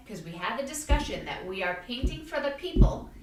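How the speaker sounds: ordinary delivery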